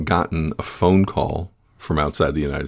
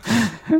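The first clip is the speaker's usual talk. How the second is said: wild rambling cackles. Real, not acted. High laugh.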